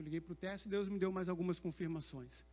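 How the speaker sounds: ordinary delivery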